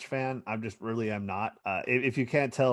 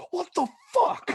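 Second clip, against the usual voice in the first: high, drawn-out yell of outrage; retold road-rage yell; 4 dB louder than usual. Driver yells at the truck after honking, high outraged shout. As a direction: stretch it out in a high mock scream.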